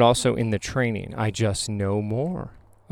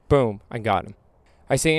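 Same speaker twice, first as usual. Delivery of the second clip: usual speaking voice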